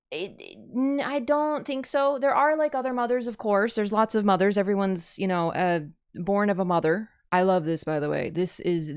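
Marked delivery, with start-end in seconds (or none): none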